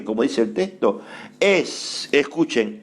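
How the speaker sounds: ordinary talk, nothing marked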